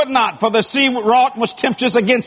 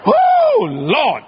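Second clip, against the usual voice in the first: sick fish outcry; 7 semitones higher than usual. Retold or imitated. The fish groaning as it spits Jonah out. Fish's outcry before vomiting.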